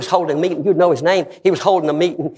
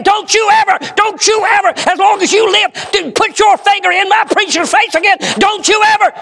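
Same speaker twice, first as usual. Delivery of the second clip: high, loud retold shouting, pounding out each word; furious yell; 4 dB louder than usual. Young convert defending his preacher, furious high shout.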